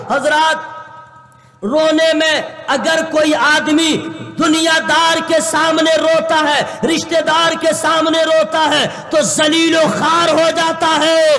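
fiery preaching. Acted. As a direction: build the crowd with rising fervor. Full-force preaching.

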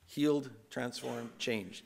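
slow, each word weighed; slow and deliberate. Real, not acted. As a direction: let each word land slowly. Slow emphatic list.

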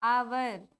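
"आवर" starting on an open "aa", no h; pronunciation demo. Shown as a demonstration. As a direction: say "आवर" with the h silent. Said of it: "तो क्या पढ़ते हैं लोग?".

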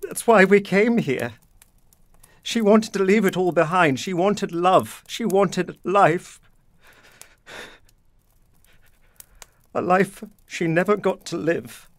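anguished outburst. Acted. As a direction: let the grief burst out, dragging each phrase. Father confesses wife's lost life.

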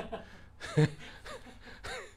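squeaky giggle; high breathy laugh. Real, not acted. Pastor laughing at the heater story, high giggle.

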